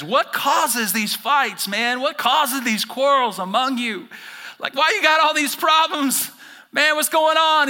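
loud, slow, pleading appeal; paraphrasing James. Retold or imitated. Pastor voicing James asking the church, loud and slow. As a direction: press the question on the crowd. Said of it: "James says".

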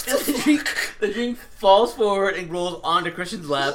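slow, high, breathy with laughter; barely getting it out through laughs; giggling through the story; laughing while telling. Real, not acted.

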